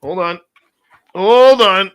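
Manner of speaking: usual speaking voice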